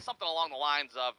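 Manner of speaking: usual speaking voice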